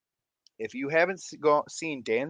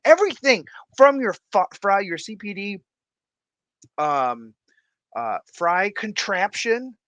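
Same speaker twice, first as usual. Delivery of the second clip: excited; 7 semitones higher, 5 dB louder than usual. Real, not acted. Excited praise.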